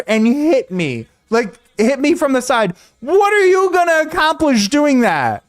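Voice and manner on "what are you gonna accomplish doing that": much higher, heated mock telling-off; incredulous rant. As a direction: scold the reckless driver as if he could hear you. Ranting at the swerving driver.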